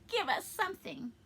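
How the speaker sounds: a touch of pleading from a beggar; begging. A narrator's reading. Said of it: "they begged".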